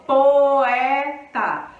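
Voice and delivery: poeta with o and e each full and loud; both vowels strong. Shown as a demonstration. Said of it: "o O bem definido e o E bem definido".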